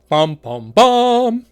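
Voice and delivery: sung suspense sting rising to a loud bum; hammed-up dun dun dun; mock suspense jingle, last note high and loud; mock dramatic drumroll. Dramatic suspense sound effect.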